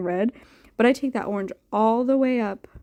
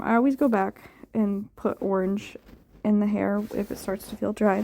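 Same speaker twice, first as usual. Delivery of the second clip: usual speaking voice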